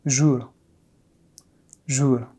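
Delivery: jour with a barely heard French final r; very subtle final r. Shown as a demonstration. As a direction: let the r in jour almost vanish. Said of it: "it's even more subtle".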